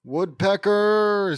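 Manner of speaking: loud, high, long-held cry; drawn-out exclamation. Acted. Wally and Meanie crying out together, drawn-out exclamation. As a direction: stretch the word out loudly. Two characters exclaim in unison.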